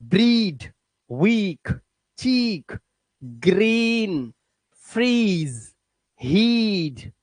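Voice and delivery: ब्रीड, वीक, चीक, ग्रीन with a stretched ee; long ee. Shown as a demonstration. Teacher drills double e, long ee held. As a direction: draw out the ee in each word, one at a time. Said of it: "ये भी बड़ी ई की मात्रा है".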